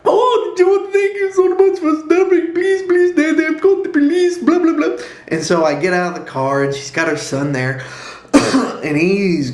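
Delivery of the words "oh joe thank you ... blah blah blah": upset Indian woman. Retold or imitated. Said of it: "this Indian woman".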